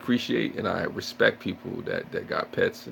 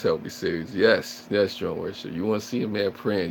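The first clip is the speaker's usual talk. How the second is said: ordinary talk, nothing marked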